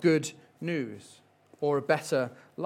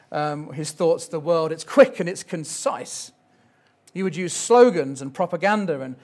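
ordinary talk

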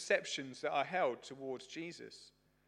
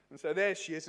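normal talking voice

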